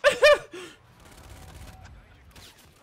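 high loud burst of laughing; loud laughter. Real, not acted. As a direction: cackle out loud. Laughing at enemy in game.

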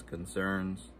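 usual speaking voice

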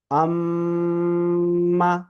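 আম্মা with a long, steady nasal hum on the mm; ghunna. Shown as a demonstration. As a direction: hold the ম্ম in আম্মা very long through the nose, on one pitch. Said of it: "এখানে আমরা গুণনা পড়ব". (আম্মা-র ম্ম নাকে টেনে গুন্নাহ করে)

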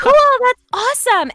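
high-pitched imitated cheery reply; feigned enthusiasm. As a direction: act thrilled while having no clue. Retells her clueless upbeat reply.

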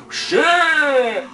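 sudden loud high yelp; startled. Real, not acted. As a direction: jolt of real fright. Startled by giant skeleton.

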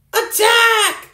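rally the troops; battle cry. Real, not acted. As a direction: yell to charge. Rallying friends to fight.

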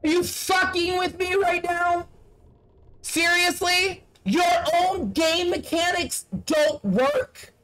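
can't believe the game is broken; incredulous. Real, not acted. Player raging at the broken game, incredulous. Game mechanics failing him.

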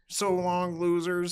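high, loud movie-robot impression. Guest quoting a film character for the hosts, robot voice. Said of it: "The one who does the robot voice?".

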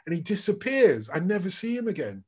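ordinary talk

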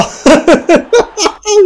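high-pitched burst of laughter; incredulous laugh. Real, not acted. Gamer cracking up at his own mishap, high laugh. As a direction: crack up in disbelief.

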